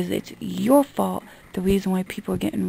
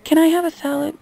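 high, loud mimicry of a customer asking; mocking high-pitched request; 8 semitones higher, 5 dB louder than usual. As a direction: imitate a customer's raised, pleading question. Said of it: "don't go".

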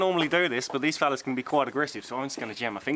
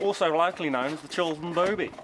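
ordinary talk, nothing marked

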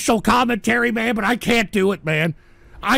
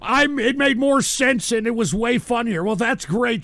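usual speaking voice

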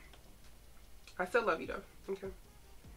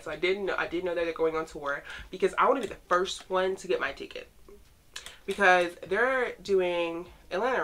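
normal talking voice

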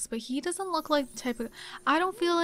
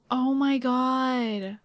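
slow, drawn-out louder disbelief; shocked awe; 3 dB louder, much slower than usual. Real, not acted. Gamer shocked her Sims went ahead, slow stunned gasp. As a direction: stretch it out, stunned.

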